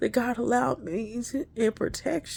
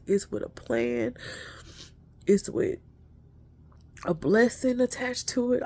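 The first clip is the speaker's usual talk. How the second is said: ordinary talk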